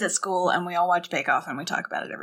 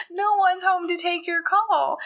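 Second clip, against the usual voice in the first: laughing; 9 semitones higher than usual. Real, not acted. Laughing at Jürgen's unanswered phone.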